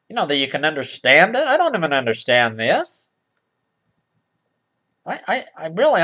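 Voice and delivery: ordinary talk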